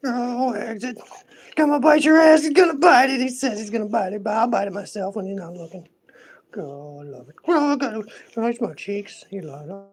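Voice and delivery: high, contented pampered pet; spoiled pet voice. Pretend. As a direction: speak as a pet basking in attention. Voices the pet being teased.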